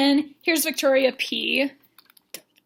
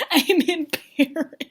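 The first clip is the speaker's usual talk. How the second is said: laughing through the words, high and slow; embarrassed laughing; 5 semitones higher, 4 dB louder, slower than usual. Real, not acted. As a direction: crack up at your own silliness. Laughs at own sound effects.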